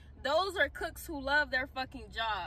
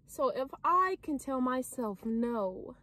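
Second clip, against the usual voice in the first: ordinary delivery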